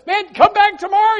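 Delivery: high, loud brush-off; mock exasperated shout. Sleeper sends the neighbour off, mock exasperated shout. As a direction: yell to get rid of him. Acted quarrel at the door.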